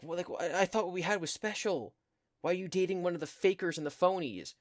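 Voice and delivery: high, wounded mock-teen complaint; indignant protest. Goth girl confronting the boy dating a blonde girl, indignant. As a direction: act out a jilted girl's indignant outburst.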